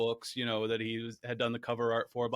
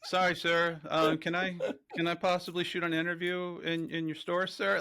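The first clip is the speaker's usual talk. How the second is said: higher-pitched, halting imitation of a starstruck kid; timid kid; 6 semitones higher than usual. Nervous fan asks store owner for interview, mock-timid kid. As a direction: ask haltingly, like a kid before a grown-up. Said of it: "I felt like a kid in a comic shop".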